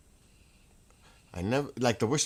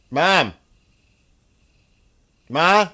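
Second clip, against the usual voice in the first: calling out loud; 8 semitones higher, 13 dB louder than usual. Real, not acted. Bill calls his mother about the open door, shouting. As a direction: shout Mom across the house, much louder and higher.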